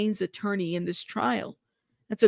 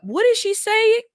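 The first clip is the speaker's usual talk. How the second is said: excited; 13 semitones higher, 4 dB louder than usual. Real, not acted. Excited reaction to news.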